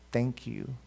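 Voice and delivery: quiet, heartfelt gratitude; sincere, deep thanks. Shown as a demonstration. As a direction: say it as if you mean it deeply. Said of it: "With sincerity and, and depth".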